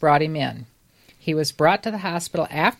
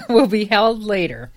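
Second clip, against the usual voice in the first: ordinary delivery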